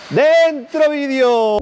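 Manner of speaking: high, very slow hyped call; drawn-out announcement. Acted. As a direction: stretch it out like a show opener. Announcing start of video.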